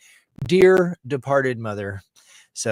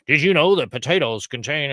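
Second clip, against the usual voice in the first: lightly voiced news reporter, a bit louder; 4 semitones higher, 5 dB louder than usual. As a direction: imitate an alarming news item.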